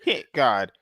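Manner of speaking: normal talking voice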